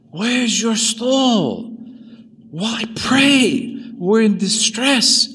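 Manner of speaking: high, loud and slow, a wailing ghost; ghost's wailing complaint. Acted. Dead mother cries out to her priest son, high wailing. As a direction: cry out like a suffering soul.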